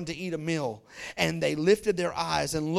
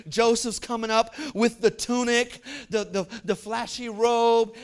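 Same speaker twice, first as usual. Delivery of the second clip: ordinary talk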